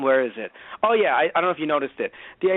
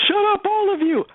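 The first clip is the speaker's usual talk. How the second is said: mock high shout; 3 dB louder than usual. Woman lantern snaps at others.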